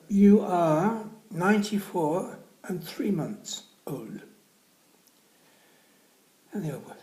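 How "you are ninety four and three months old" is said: slow, loud, high deliberate pronouncement; old lady verdict. Acted. Old lady to the old boy, slow and high. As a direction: weigh each word like a verdict.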